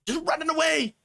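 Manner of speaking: high, put-on squeal of a coward; high mocking squeal about cowards fleeing; mock scared squeal. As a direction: shriek it like a scared child.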